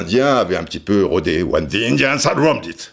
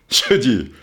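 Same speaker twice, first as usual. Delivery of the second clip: usual speaking voice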